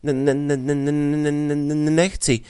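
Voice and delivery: put-on a frightened boy stuck on every syllable; scared stutter. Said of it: "čím dál tím víc ustrašený".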